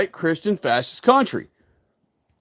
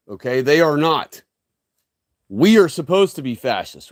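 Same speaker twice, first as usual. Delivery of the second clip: ordinary talk, nothing marked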